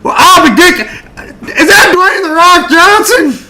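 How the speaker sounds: high, loud, thrilled squeal; starstruck amazement. Acted. Homeowner realizes it was a famous star, starstruck squeal. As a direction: squeal in astonishment, drawing out the question. Character recognizes a celebrity.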